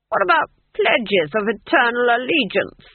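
high, loud, affronted question; disappointed ruler protesting; indignant protest. A narrator's reading. Duke protests in disbelief.

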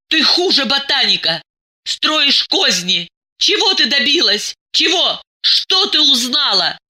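high-pitched, heated reproach; angry berating. A narrator's reading. Diana berates Masha for scheming, angry.

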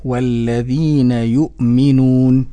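"والذين يؤمنون" slow, low, with measured long vowels; correct recitation. Shown as a demonstration. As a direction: model "والذين يؤمنون" firmly, with a still hamza. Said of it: "إذن فالنطق الصحيح". ("والذين يؤمنون" بنطق صحيح متأن)

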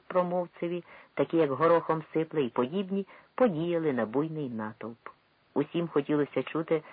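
ordinary delivery

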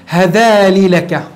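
هذالي لك dragged out slowly; deliberate example of an error; slow wrong form. Shown as a demonstration. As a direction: stretch the forbidden هذالي لك. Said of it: "ولا يجوز".

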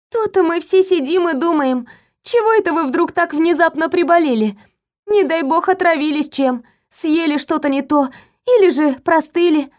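high, chatty maid twitter; chirping. A narrator's reading. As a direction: chirp on cheerfully like a busy maid. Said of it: "продолжила щебетать служащая".

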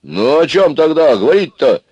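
loud, high-pitched protest; rebellious protest. A narrator's reading. Аристарх revolts while on his knees, rebellious protest. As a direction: push back, indignant. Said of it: "взбунтовался Аристарх на коленях".

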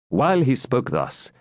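plain reading voice

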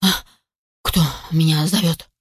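put-on low, drowsy old grandad voice; just-woken old grouse. A narrator's reading. Old grouse wakes to puppy's barking, drowsy. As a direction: ask as if roused from a doze. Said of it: "проснулся наконец глухарь".

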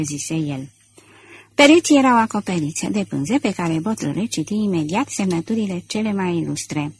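ordinary delivery

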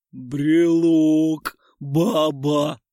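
loud, dull gleeful troll; dopey troll voice. A narrator's reading. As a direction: grunt it out like a happy dim troll.

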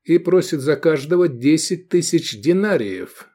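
high-pitched, declaimed price; with expression. A narrator's reading. Pilot naming his price for each slave, with expression. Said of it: "с выражением проговорил пилот".

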